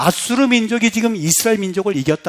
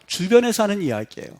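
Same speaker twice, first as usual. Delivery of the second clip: usual speaking voice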